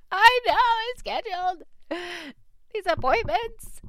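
high, loud, laughing self-mockery. Real, not acted. Admitting to her husband she booked the visits, laughing. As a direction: laugh at yourself, voice jumping up high.

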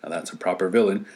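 plain reading voice

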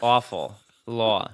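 Awful and Law with a broad Jersey aw. Shown as a demonstration. Proving her Jersey accent with a broad aw. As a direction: lean on the New Jersey aw vowel. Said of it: "a little bit of an awe 'cause I'm from Jersey".

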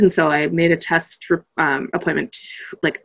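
normal talking voice

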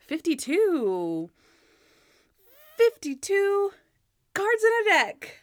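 stretched, high game-show call; hammed-up awkward presenter; [name]. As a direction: draw out the number, then proudly deliver the quip. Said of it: "Bad [name] acting is so funny".

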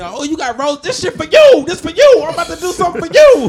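high and very loud; mock excited girlfriend. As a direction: gush over the roses, overdone. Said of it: "And she said".

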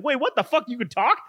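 high-pitched incredulous question; mock astonishment. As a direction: imitate someone stunned by a talking object. Voicing the owner of a talking pillow.

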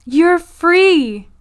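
high, loud imitated proclamation; acting out a jubilant declaration; triumphant announcement. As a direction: proclaim freedom in a mock-grand voice. Acting out Nadine's liberating announcement.

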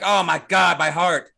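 hammed-up alarmed outburst; mock alarm. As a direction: exclaim in exaggerated shock. Mocking the oblivious scientists' reaction.

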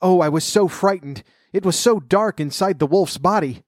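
put-on a mild frightened cry from a rescued girl. A narrator's reading. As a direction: blurt it out, still scared from the dark. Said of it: "and the girl jumped out and cried".

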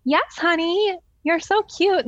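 put-on an older lady cooing at a toddler; sweet old lady. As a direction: coo it like a charmed grandma. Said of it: "The lady says".

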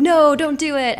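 acted-out inner alarm; urgent self-warning. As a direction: plead with yourself to resist. Arguing with oneself at the drive-thru.

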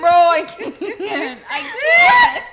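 high, loud, shrieking laughter; can't stop cracking up; hysterical laughing. Real, not acted. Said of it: "Not the fucking laugh".

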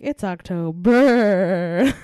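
slow, loud punchline; drawn-out joke delivery. Retold or imitated. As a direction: stretch it out for the pun. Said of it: "and was like".

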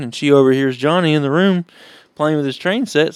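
usual speaking voice

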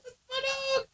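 high-pitched retold exclamation; excited recognition. Dog owner spotting her lost pet online, excited. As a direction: pipe up in sudden recognition.

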